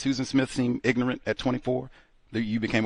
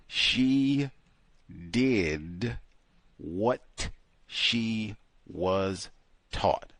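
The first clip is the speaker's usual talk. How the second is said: drawn-out words with long pauses; slowed right down; much slower than usual. Shown as a demonstration. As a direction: replay it very slowly, word by word. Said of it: "you were speedballing those words out".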